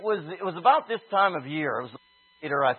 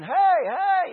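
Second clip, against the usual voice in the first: screaming; 12 semitones higher, 3 dB louder than usual. Retold or imitated.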